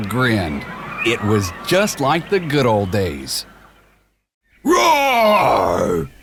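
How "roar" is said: big bellowing ogre roar. A narrator's reading. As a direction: roar to scare the picnickers. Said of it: "he yelled".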